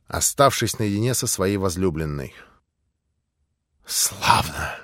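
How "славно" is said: a mild, low spiteful славно; understated malicious cry. Acted. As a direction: spit it out mercilessly. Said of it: "злобно и беспощадно крикнула".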